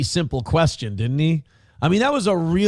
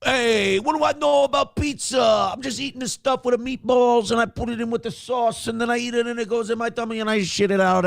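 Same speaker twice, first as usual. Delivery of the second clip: high, shouted dumb Italian guy; Italian-American accent; 8 semitones higher than usual. Retold or imitated. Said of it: "Dave Portnoy's like".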